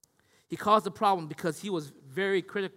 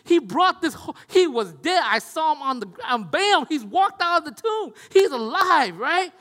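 much higher and louder, breathless news of a miracle; excited hype man; 7 semitones higher, 8 dB louder than usual. Retold or imitated. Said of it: "hype men going".

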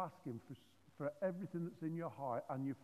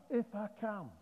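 higher-pitched, mildly incredulous repeat; frustrated echo; 5 semitones higher than usual. Acted. Jesus repeating the father's words back, mildly frustrated. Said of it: "He actually asked, asked him that".

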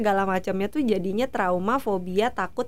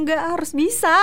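ngotot menyemangati diri; 6 semitones higher, 3 dB louder than usual. Acted. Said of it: "semuanya harus bisa gitu".

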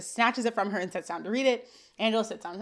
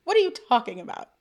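higher, louder mock exasperation; incredulous; 7 semitones higher, 7 dB louder than usual. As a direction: call him out in disbelief. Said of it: "I'm like".

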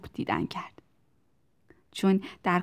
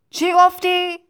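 high, loud "what was that?" call; shouted question; 11 semitones higher, 10 dB louder than usual. A narrator's reading. Factory owner failing to hear Tistu, shouting back. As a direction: yell over a racket.

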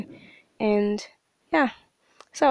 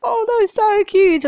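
much higher, louder gushing squeal; 12 semitones higher, 8 dB louder than usual. Retold or imitated. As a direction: melt over something adorable. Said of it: "there are times that I'm like".

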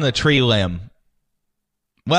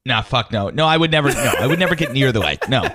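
usual speaking voice

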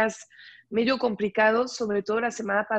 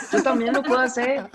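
northern Mexican accent; 3 semitones higher, 6 dB louder, faster than usual. Shown as a demonstration. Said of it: "ya te escuché el acento norteño".

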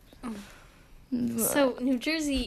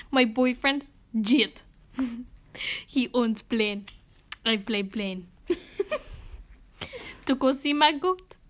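halting put-on Russian accent; Helga. Introducing a boyfriend and plane, mock Russian accent. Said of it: "impression of Liza doing an impression of Helga".

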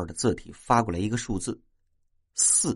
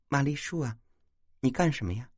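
put-on soft, slightly raised bashful girl's voice; bashful asking; 4 semitones higher, 4 dB quieter than usual. A narrator's reading. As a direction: ask shyly and softly, blushing. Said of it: "满脸羞红地问". (娇羞地轻声问)

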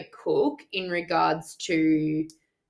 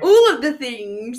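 excited; 5 semitones higher, 8 dB louder than usual. Real, not acted. Excited about new things.

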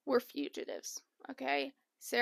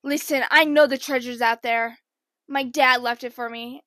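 John B line; 9 dB louder than usual. Retold or imitated. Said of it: "John B would be like".

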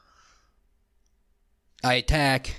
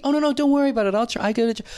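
much higher mock-polite waving away; imitated polite refusal; 7 semitones higher, much faster than usual. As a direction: act out an easy, high-pitched no-thanks. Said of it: "I've seen plenty of the".

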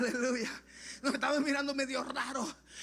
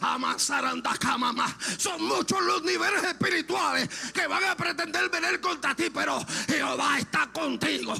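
full-force preaching; 3 semitones higher, 5 dB louder than usual. Acted. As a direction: cry it out to the congregation in a ringing voice.

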